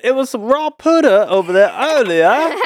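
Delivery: high, hammed-up mock British; laughing, exaggerated British lilt; attempted British accent. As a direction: joke in put-on British, amused. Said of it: "that was British. That was good".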